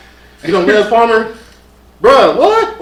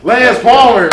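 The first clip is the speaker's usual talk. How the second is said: light announcer build on the name; ring announcer intro; 6 semitones higher, 6 dB louder, slower than usual. Acted. Introducing the champion to the audience, light announcer voice. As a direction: start the introduction, not yet full blast. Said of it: "ladies and gentlemen".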